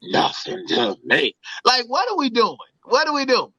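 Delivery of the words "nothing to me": mock-offended coworker telling the story; pearl-clutching woman. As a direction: drop low and drag it out, shocked. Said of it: "clutch her pearls".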